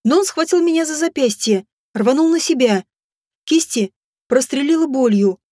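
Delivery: plain reading voice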